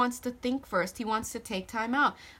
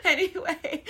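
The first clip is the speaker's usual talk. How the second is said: high, louder, cracking up; laughing embarrassment; 9 semitones higher, 5 dB louder than usual. Real, not acted. Reader mock-quitting after a bad accent, laughing helplessly. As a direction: talk through a fit of laughter.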